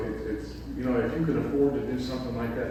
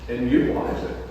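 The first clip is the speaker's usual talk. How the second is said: usual speaking voice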